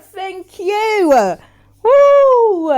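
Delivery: loud high whoop of joy; loud high joyful whoop. Real, not acted. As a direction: cheer at the screen. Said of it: "A Jamaican came out of me".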